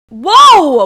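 loud cracking yelp. Real, not acted. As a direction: voice breaks on the word. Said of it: "What did my voice do?".

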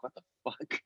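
usual speaking voice

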